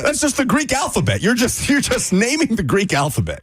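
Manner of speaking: exasperated. Real, not acted. Exasperated at slang.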